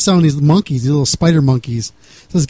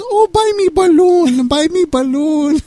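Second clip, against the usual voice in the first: put-on much higher, slow, childlike pleading; mock broken-English girl; 12 semitones higher, slower than usual. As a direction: beg for it like a little girl. Teller voices the woman's request.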